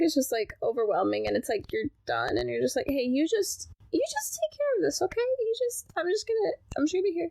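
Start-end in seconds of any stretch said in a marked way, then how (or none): 2.9-7.3 handing it off. Retold or imitated. Voicing a tired, handing-off person.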